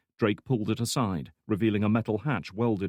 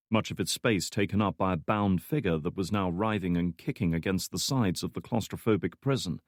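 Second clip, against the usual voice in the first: ordinary delivery, nothing marked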